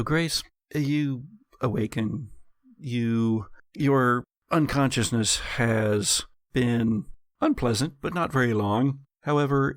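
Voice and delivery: usual speaking voice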